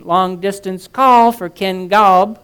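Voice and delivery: loud, slow formal operator voice; crisp telephone operator, drawn out and loud. A narrator's reading. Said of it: "The operator said".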